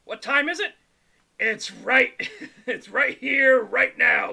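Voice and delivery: loud, hammed-up message reading; hyped shout. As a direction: belt it out, laughing midway. Reads chat message as hyped shout.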